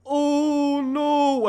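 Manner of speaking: a dragon's wheezy gasp of "oh no"; lightly voiced dragon, high and airy; breathy dragon. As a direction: let it come out as a weak breathy sigh. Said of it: "looks around and says".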